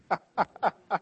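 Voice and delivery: real, drawn-out chuckle at his own joke; hearty laugh. Real, not acted. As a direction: break up laughing.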